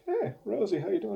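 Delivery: high-pitched, retold friendly hello; retold greeting.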